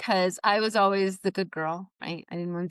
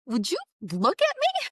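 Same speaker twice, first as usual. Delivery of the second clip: frustrated boss; 12 semitones higher than usual. Retold or imitated. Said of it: "he was frustrated".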